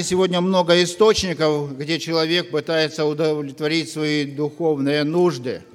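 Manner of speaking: ordinary talk, nothing marked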